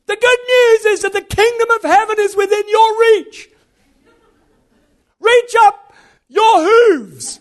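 very high, loud pulpit shout; full-force mock preaching. Farmer preaching to his Jersey cows, high booming proclamation. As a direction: bellow a mock sermon across a field. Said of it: "But I just started preaching".

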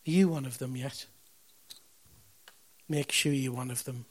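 ordinary delivery

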